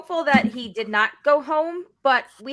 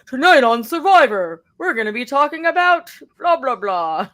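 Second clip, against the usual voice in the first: announcer voice; 6 dB louder than usual. Retold or imitated. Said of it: "where it's like".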